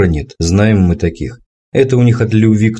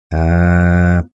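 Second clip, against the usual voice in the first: drawn out. Acted. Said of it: "протянул дед".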